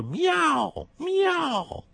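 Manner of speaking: slow then very high meow. Acted. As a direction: mew like a cat. Rooster teaches meowing.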